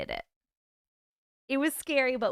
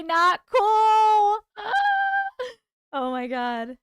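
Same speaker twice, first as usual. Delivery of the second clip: mock outrage; 10 semitones higher, 7 dB louder, much slower than usual. Real, not acted. Reacting to a scary episode.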